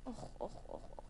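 whispered ouch sounds; tiny stuck ant's whimper; pained groans. Acted. Ant stuck in honey, whispering ouch.

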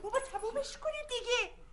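high, slow pleading cry; a wife pleading with the men to end the fight, high and slow; exasperated plea. Acted. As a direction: beg them to stop, drawn out and high. Wife begging the men to stop.

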